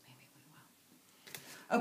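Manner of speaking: slow conspiratorial whisper; teasing whisper. Real, not acted. Promising viewers wild boar, a slow teasing whisper. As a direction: whisper it slowly like a secret.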